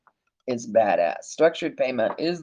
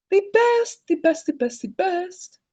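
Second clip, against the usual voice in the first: high, giddy repetition fading away; delighted chant of the best; gushing; 11 semitones higher, 4 dB quieter than usual. Real, not acted. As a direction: sing the praise higher and higher, then let it trail off. Gushing over tier four B.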